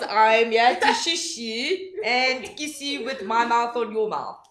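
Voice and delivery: slow creepy seductive voice. Pretend. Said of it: "mocking creepy message".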